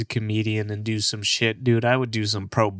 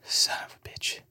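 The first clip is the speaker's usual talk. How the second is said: breathy whispered curse, under the breath; 9 dB quieter than usual. Real, not acted. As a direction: mutter it almost silently to yourself. Cursing under breath after missed sneeze.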